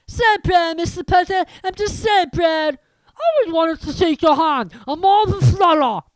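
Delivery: high, loud, gushing admirer; proud fan. Acted. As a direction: burst with pride shaking his hand. Another fan voiced in story.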